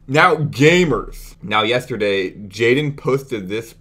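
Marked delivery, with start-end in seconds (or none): 0.1-1.3 slow, louder, higher "Now, gamers"; drawn-out call to attention. Real, not acted. Streamer to his viewers, slow loud summons. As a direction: stretch it out to grab the room.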